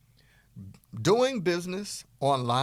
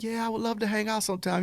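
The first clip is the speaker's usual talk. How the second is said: higher, lightly voiced woman; woman accepting; 9 semitones higher, faster than usual. Woman accepting a date, lightly voiced and higher. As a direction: play her sweet and agreeable. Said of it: "And she's like".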